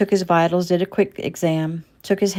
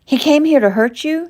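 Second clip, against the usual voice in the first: much higher, louder alarmed cry; 11 semitones higher, 7 dB louder than usual. A narrator's reading. Mother crying out on hearing her son was targeted. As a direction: cry it out in shock. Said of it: "Shelley cried loudly".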